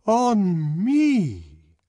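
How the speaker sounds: high, loud, slow offended complaint; indignant. A narrator's reading. The arch songster complaining to the guests, loud indignant. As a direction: sound deeply affronted. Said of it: "the arch songster kept repeating".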